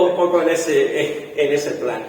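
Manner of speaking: ordinary talk, nothing marked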